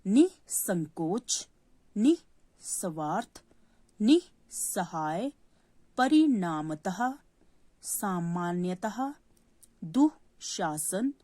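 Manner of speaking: very slow, syllable by syllable; slow repeat-after-me. Shown as a demonstration. Teacher says four-letter visarga words very slowly for pupils. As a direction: stretch each word out for children to copy. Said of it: "मेरे पीछे repeat करना है".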